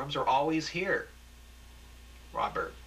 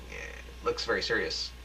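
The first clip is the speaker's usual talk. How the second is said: plain reading voice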